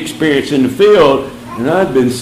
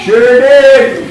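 mock cheerful; 9 semitones higher, 5 dB louder than usual. Mock cheerful answer to pastor.

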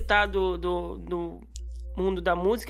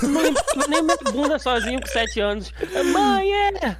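criança chamando a mãe; 5 semitones higher, 4 dB louder than usual. Pretend. Zoeira imitando criança chamando mãe.

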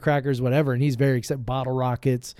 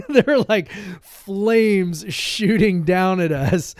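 higher, louder, slow and amazed; excited, raised voice; 5 semitones higher, 5 dB louder, slower than usual. Real, not acted. Dad telling friend how flames shot down at them, loud and high. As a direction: relive the scare loudly and slowly.

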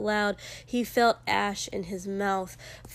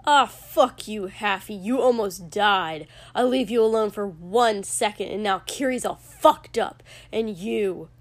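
loud, punched angry outburst; furious rant; 5 dB louder than usual. A narrator's reading. Furious man berating someone who almost died, enraged. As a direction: tear into them, leaning hard on words. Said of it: "looking utterly furious".

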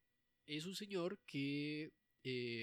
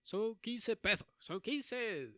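louder, slow Spanish ferryman calling fares; Spanish boatman; 5 semitones higher, 5 dB louder, slower than usual. Acted. The boatman announcing fares to passengers, loud and slow. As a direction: hawk the fare like a pushy boatman. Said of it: "Y le dice".

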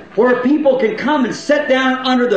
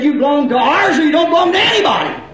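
fast, raised mock shout; dogmatic locust; 5 semitones higher, faster than usual. Preacher voicing the locust church, mock shouted ultimatum. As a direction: bark it out like an exclusive church boss. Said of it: "all said".

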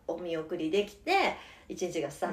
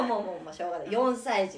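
ordinary talk, nothing marked

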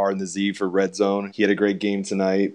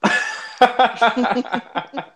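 high laugh. Real, not acted. Laugh at biceps.

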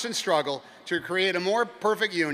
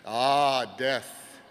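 put-on wise old sage; 3 semitones lower than usual. Wise man muses.

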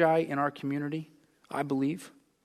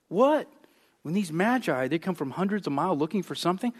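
normal talking voice

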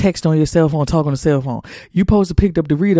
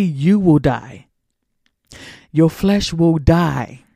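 solemn warning; 2 semitones lower, slower than usual. Acted.

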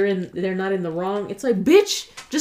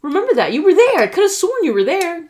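high, loud incredulous; exasperated disbelief; 8 semitones higher, 4 dB louder than usual. Retold or imitated. Insisting to Roberto he was there, high and exasperated.

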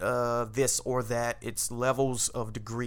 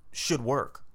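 ordinary talk, nothing marked

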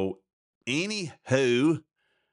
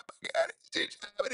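mock weepy; 7 dB quieter than usual. Mock grief over broken heart.

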